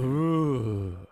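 long drawn-out "ooh"; mock ghostly moan. Retelling the noise from the bathroom, mock ghostly moan. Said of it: "he said the sentence".

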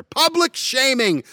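high, loud, slow proclamation of public shaming; mock proclamation. Ranting vlogger proclaiming public shaming, loud and high. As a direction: announce it like a town crier.

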